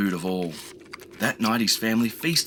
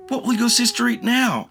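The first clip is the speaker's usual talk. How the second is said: high, louder scolding mother voice; angry mother; 13 semitones higher, 5 dB louder than usual. A narrator's reading. Mother scolding Anki over the lost egg, angry and loud. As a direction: scold him for throwing the egg away. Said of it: "asks Anki's angry mother".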